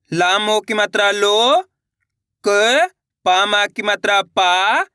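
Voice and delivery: slow spelling-out, long rising लो and पा; syllable-by-syllable reading. Shown as a demonstration. Teacher leading learners to repeat along, slow and drawn out. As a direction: leave gaps for learners to repeat. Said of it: "मेरे साथ साथ इनको repeat करना है".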